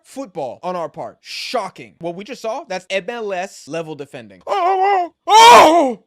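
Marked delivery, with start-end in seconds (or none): 4.5-6.0 loud, high panicked cries; panicked shout. Real, not acted. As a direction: yell in alarm at the chance. Dangerous chance reaction.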